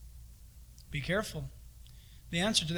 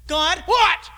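high, loud irritated what; mock impatient reply; annoyed snap; 8 semitones higher, 6 dB louder than usual. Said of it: "Can you imagine".

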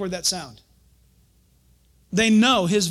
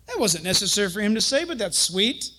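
normal talking voice

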